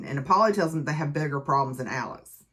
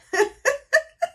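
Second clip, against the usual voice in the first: laugh; 5 dB louder than usual. Real, not acted. Laughs at her own retelling.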